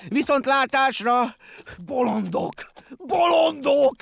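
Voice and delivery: high, drawn-out scornful yell; loud, exasperated shouting; furious bellowing. A narrator's reading. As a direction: roar it slowly at a crowd of fools. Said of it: "üvöltötte Benyámin".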